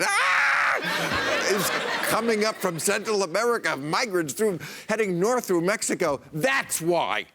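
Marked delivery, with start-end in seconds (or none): 0.0-1.5 high-pitched mock scream of panic. Comic mocking a TV scare about a caravan, mock scream. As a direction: yelp in pretend terror.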